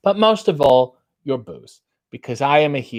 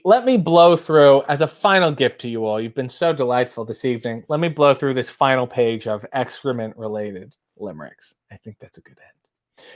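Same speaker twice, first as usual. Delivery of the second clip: ordinary talk, nothing marked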